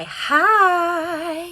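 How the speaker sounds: calling hi to her son across the room; cheerful hi to son. Real, not acted. Waving son interrupts livestream.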